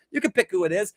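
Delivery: normal talking voice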